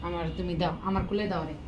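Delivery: ordinary talk, nothing marked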